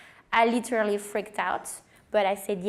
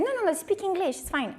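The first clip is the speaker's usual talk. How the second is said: calm her worry lightly; reassuring; 9 semitones higher than usual. Retold or imitated.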